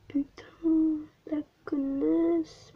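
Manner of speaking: ordinary talk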